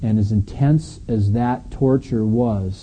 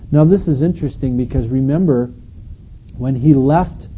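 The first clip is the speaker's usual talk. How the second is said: normal talking voice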